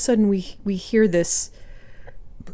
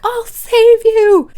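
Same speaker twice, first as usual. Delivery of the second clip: drawn-out, much higher and louder mock rescue shout; heroic cry; 10 dB louder, much slower than usual. Kicking the outhouse door down to rescue crying Ben, hammed-up heroic yell. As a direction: ham up a kid superhero bursting in. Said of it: "and I was like".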